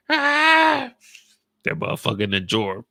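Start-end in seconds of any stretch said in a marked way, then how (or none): 0.1-0.9 loud falsetto squeal; high loud squeal. Acted.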